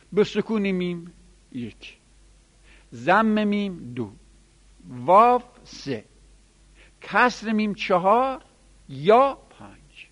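slow, emphatic enumeration of each form; slow counting of variants. Shown as a demonstration. Teacher counting the five readings, slow and emphatic. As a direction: count the forms off slowly, one by one.

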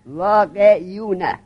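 واقعیونه very slowly as vā-qe-yu-na; واقعیونه stretched out patiently for a student; original uncontracted form. Shown as a demonstration. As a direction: spell out each syllable of واقعیونه, y included. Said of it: "ببین جون من".

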